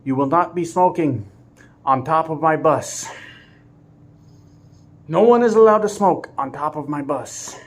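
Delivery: lightly voiced stern driver laying down rules; hammy mock lecture, my bus stressed; a hint of a deadpan bossy driver; imitating the new driver. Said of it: "hilarious the way he said it".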